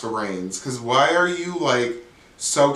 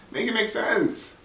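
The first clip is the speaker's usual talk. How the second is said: exasperated plea; 10 semitones higher, slower than usual. Real, not acted. Exasperated recap of messy episode.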